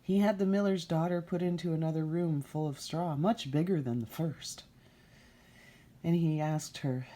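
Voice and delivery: ordinary delivery, nothing marked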